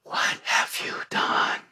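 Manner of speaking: hushed, slow accusing whisper; seductive whisper. Retold or imitated. The enemy turning on the believer, in a seductive whisper. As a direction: whisper the blame like a tempter. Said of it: "in that seductive voice turns right around and says".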